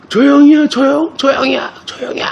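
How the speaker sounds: put-on a younger boy timidly naming the boy who hit him; child naming names. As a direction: point out the culprit like a nervous little kid.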